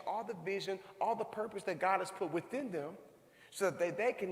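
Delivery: high, ringing sermon build; preaching at full force. Acted. Preacher rousing the school gathering with a high ringing call. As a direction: preach it out to the crowd.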